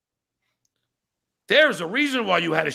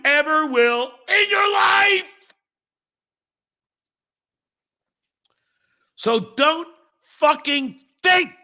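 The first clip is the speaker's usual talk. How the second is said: furious shout; 8 semitones higher than usual. Real, not acted. Streamer yelling at a viewer who called him an idiot, furious shout. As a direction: shout him down with every beat stressed.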